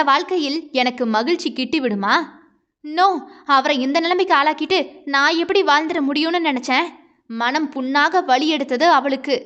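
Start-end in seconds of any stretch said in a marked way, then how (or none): none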